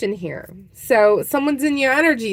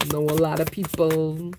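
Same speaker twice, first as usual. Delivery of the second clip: mock Godfather; 5 semitones lower, 5 dB quieter than usual. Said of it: "The Godfather".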